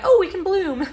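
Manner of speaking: high, bright singsong for the blooming plants; mock voice of the plants. Vlogger voicing the plants about her allergies, in a high mock singsong. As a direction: pretend to be spring saying it can bloom. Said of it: "everything was like".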